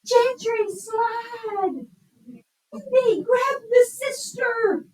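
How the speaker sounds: very high, drawn-out yell; exclamation with strong feeling. Shown as a demonstration. Teacher acting out urgent example sentences, excited exclamation. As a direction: holler it high, stretching the words. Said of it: "sentences that are showing strong emotion".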